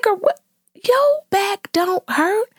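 high, slow disbelieving comeback; incredulous retort. Retold or imitated. Mom calling out the toddler's fake pain, high incredulous. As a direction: act stunned at her excuse. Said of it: "I said".

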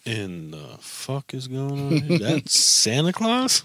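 high, stunned disbelief; shocked kid. Retold or imitated. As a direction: gasp it out in disbelief. Kid's shocked reaction to Santa.

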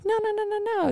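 tiny, very high, soft angel voice; Minnie Mouse. Acted. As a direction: squeak it like a little cartoon mouse. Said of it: "It's like Minnie Mouse".

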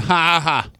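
mocking ha ha laugh at a hurt man; hammed-up mocking laughter. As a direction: jeer with a fake laugh. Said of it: "someone's laughing at him, going".

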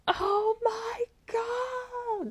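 high, slow, drawn-out cry of panic; reliving a moment of panic during birth, crying out; panicked cry. Retold or imitated. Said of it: "panic moment inside thinking".

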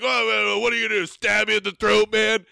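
high, whiny mock comeback; imitating himself drunkenly goading; drunk taunt. Retelling his drunk taunt.

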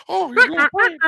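very high laughing clown voice; clownish impression. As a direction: squeak it out like a clown. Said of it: "That's a horrible impression".

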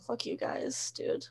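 whispered puppy voice. Retold or imitated. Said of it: "He's like".

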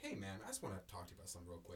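quick, low, softened imitation; discreet aside. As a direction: imitate someone quietly taking a friend aside. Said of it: "wrap their arm on my shoulder".

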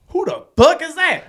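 high, loud angry yell; furious shout. Retold or imitated. Yelling at wife about mysterious Toby, furious shout. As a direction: demand it at full volume. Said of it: "And I'm like".

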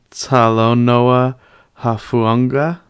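slow, tentative pronunciation of Talanoa Hufanga; stretched-out, unsure go at an unfamiliar name; careful name attempt. Real, not acted. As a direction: sound out Talanoa Hufanga carefully, rising at the end. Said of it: "don't wanna mess up his name".

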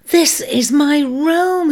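high, slow, exultant cry of homecoming; excited cry. A narrator's reading. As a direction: proclaim it with joy. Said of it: "he cried".

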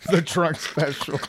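laughing through the words; real uncontrollable laughter. Real, not acted. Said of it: "twenty-six of us laughing".

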